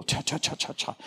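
soft unvoiced cha-cha tapping noise; texting sounds. Imitating kids secretly texting under the dinner table, soft voiceless tapping. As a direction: whisper the tap of thumbs on a phone.

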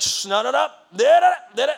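high, loud dismissive babble; mocking shushing. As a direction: brush him off with mock nonsense. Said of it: "religion's like".

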